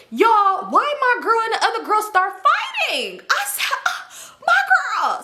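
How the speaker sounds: laughing, squeaky high retelling. Real, not acted. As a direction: crack up at the memory. Laughing while telling the fight.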